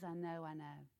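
soft, low reassurance; gentle soothing. Retold or imitated. Father gently refusing his son, soft and low. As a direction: hush him gently. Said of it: "He refuses him and says".

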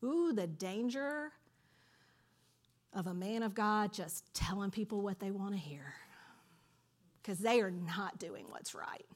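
preacher warns the congregation in a whisper; whispered warning. Real, not acted. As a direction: hush it like a solemn warning. Preacher's grave warning aside.

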